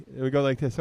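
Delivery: ordinary talk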